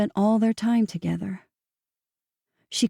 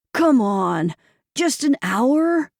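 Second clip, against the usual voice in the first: slow, higher, pleading Come on; begging; 5 semitones higher, much slower than usual. Acted. Evan begging Abby to come out, pleading. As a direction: drag it out like a whiny beg. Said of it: "began to beg".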